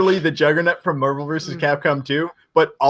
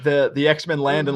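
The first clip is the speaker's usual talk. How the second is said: normal talking voice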